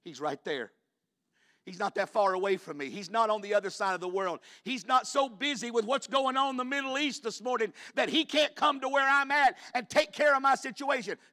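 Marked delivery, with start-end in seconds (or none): 1.6-11.2 preaching that climbs higher and louder; full-voiced preacher insisting; impassioned preaching. Real, not acted. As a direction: build steadily toward a pulpit shout.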